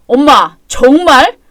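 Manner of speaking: loud, fed-up outburst; loud son at wit's end; exasperated protest. Acted. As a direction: burst out in disbelief at mother. Son protests at mother's sarcasm.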